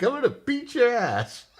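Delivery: taunting in a squeaky high put-on voice; mock taunt. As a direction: goad them into the race, high and cocky. One-legged racer taunting.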